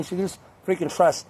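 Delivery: ordinary delivery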